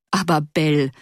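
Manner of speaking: a touch of mocking teasing. A narrator's reading. Husband teasing his wife about the untidy house, mildly mocking. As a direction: lightly tease her worries away. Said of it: "versetzte er spottend".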